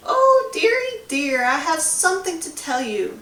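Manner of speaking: high quavery grandma ghost; old lady ghost. A narrator's reading. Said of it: "spoke a woman's voice".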